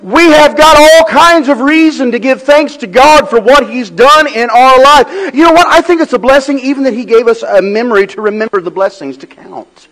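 loud, high-pitched preaching that lifts the church; preaching at full force. Acted. Pastor rallying the church to give thanks, preaching at full force. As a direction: ring out the thanks to God.

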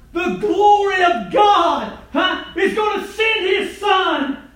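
high, loud shouted preaching; preaching at full force. Real, not acted. Preacher shouting to the congregation about Nazareth.

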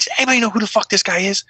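high, fast demand; frantic question. Retold or imitated. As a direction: blurt it out to the room. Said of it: "I'm like".

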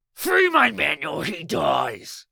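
loud, slow shouted threat; yelling threat. A narrator's reading. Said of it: "he yelled".

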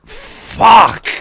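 exasperated curse. Acted. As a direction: curse at an unwelcome caller. Boss reacts to unwelcome caller.

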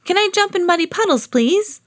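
put-on high, quick child pleading; high-pitched polite begging; Peppa. A narrator's reading. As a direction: ask fast and high, like an eager little pig. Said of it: "said Peppa".

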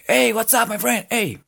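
high-pitched English hello to a pal; greeting someone. Acted. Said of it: "como si saludaran a alguien".

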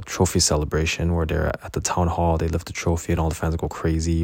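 ordinary talk, nothing marked

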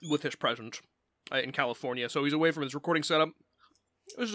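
ordinary talk, nothing marked

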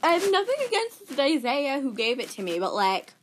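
ordinary talk, nothing marked

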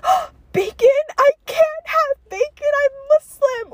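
squealed, slow, hammed-up horror; very high, drawn-out drama queen; Mean Girls. Popular girl refusing bacon, mock horrified. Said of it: "like, Mean Girls or whatever".

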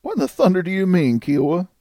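a touch of bewildered protest; half-laughing disbelief. A narrator's reading. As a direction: ask it in mild, half-amused disbelief. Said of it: "he half laughed forth".

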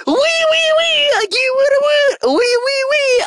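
high, loud bratty squeal; bratty kid voice. Acted. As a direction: squeal like a spoiled brat. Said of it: "my bratty voice".

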